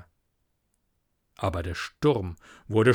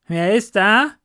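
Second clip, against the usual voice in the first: loud, much higher shout from a window; calling out; 8 semitones higher, 7 dB louder than usual. Acted. Doctor calling from his bedroom window, loud. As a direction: shout down into the night. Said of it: "rief der Doktor".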